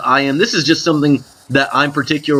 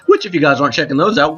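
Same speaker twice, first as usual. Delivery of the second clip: ordinary delivery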